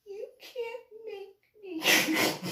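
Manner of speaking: put-on high, slow, tearful kid's voice; whiny crying boy. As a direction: play the boy whimpering defiance. Boy talks back, voiced crying.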